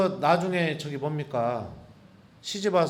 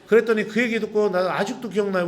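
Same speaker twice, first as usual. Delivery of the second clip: ordinary talk, nothing marked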